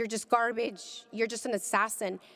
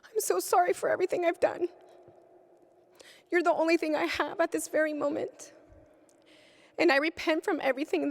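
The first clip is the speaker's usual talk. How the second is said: high, pleading cry to heaven; tearful cry to God; 6 semitones higher than usual. Retold or imitated. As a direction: beg forgiveness, pitch up high. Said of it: "I yelled at him".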